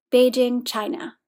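plain reading voice

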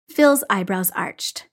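usual speaking voice

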